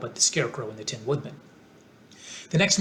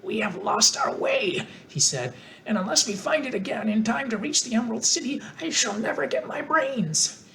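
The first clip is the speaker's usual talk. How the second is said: grumbling scarecrow; 8 semitones higher than usual. A narrator's reading. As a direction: complain like a straw man. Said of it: "began to grumble a bit".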